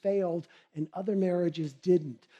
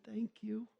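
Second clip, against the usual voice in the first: much softer, breathed out as a whisper; whispered thanks; 3 semitones higher, 13 dB quieter than usual. Acted. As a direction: whisper a meek thank you. Patient quietly thanks doctor.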